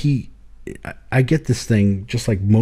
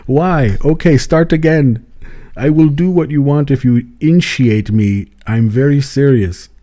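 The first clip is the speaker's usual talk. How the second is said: pleading put-on African accent, a bit louder; imitating an African accent; 3 semitones higher, 4 dB louder than usual. Zambian man insisting he is serious, put-on African accent. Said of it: "But if I do this, then it's him".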